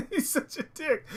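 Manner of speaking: high, giggly exasperation; helpless high-pitched laughing complaint; laughing insult. Real, not acted. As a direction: squeeze it out through a laugh. Laughing about the game character.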